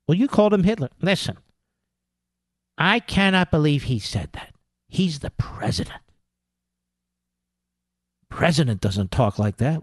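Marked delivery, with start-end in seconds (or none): 2.8-6.0 mock-appalled, sinking softer at the end; mock shocked pundit. Host mimicking pundits appalled at the president, mock-grave. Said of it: "same people who talk like this". 8.3-9.8 low mock-grave objection; mock appalled pundit. Host mimicking pundits disapproving of the president, low and mock-solemn.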